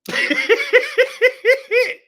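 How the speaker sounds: high, loud cackle; cackling laugh. Real, not acted. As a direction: cackle in disbelief. Reaction to tweet.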